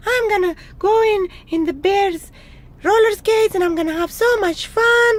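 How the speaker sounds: high, loud, gleeful mouse voice; overjoyed mouse. A narrator's reading. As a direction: gush excitedly as a little mouse. Mouse gleeful over skates.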